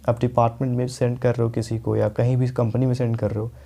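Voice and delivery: usual speaking voice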